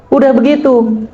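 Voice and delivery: normal talking voice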